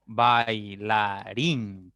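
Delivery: "bailarín" very slow, bai, la, rín; syllable by syllable. Shown as a demonstration. Teacher counting three syllables, "bailarín" slowly divided. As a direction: draw out each of three beats in "bailarín". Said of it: "tres sílabas en esta palabra".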